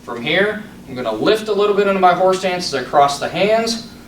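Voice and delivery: crisp called-out form commands; sharp martial arts commands. Shown as a demonstration. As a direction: call each move like a drill. Calls form movements over music.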